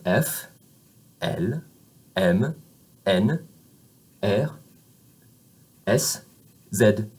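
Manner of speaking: "F, L, M" one at a time, open eh; spaced-out French letters, "Z" as zed; French letter names. Shown as a demonstration. Teacher drilling students, separated French letter names. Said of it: "that is the vowel sound that we use".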